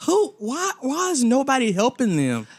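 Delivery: high disbelieving questions; incredulous. Retold or imitated. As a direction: demand an answer in dismay. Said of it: "I'm like".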